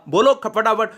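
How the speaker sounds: ordinary talk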